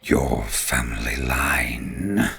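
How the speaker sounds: very low, drawn-out ent voice; slow, ponderous ent; ancient tree creature. Acted. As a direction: speak deep and slow, like an old tree.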